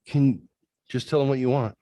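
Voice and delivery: high, urging plea; retold exasperation. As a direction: imitate someone losing patience. Retold exasperated companion ordering.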